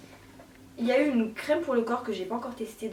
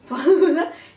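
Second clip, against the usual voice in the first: loud high laugh; real laugh at her own mess; sudden bright laugh; burst of laughter; 6 semitones higher, 11 dB louder than usual. Real, not acted.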